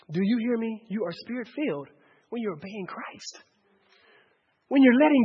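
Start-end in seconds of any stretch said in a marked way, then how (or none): 0.1-3.4 very soft, lowered preaching voice; hushed earnestness. Real, not acted. Preacher telling congregation that obeying is spirit-filled, hushed. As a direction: drop right down to press the point. Preacher drops voice for key point.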